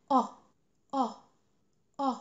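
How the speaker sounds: isolated short "أ" as in "hot", repeated; vowel demo. Shown as a demonstration. Teacher models the third short vowel for learners. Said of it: "تالت صوت معانا هو".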